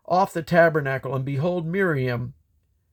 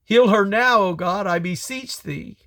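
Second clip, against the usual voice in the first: a raised, higher plea, loud on the first words; crying out; 6 semitones higher, 3 dB louder than usual. A narrator's reading. Moses begs God to heal Miriam, crying out loud. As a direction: call out to God in urgent pleading. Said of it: "And Moses cried unto Yahweh".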